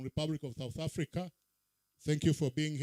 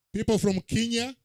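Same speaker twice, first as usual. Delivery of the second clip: calling out; 5 semitones higher, 9 dB louder than usual. Real, not acted. Roll call, calling out to audience.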